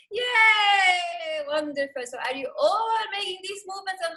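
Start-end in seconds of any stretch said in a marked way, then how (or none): 0.1-1.4 loud high cheer; cheering yay. Shown as a demonstration. Teacher leading the class cheer. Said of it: "So now in Hindi".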